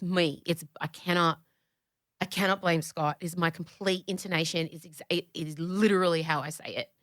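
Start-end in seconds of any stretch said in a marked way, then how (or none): none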